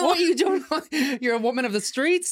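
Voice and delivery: mock scandal. Real, not acted.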